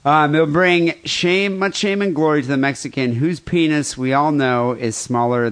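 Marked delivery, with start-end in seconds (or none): none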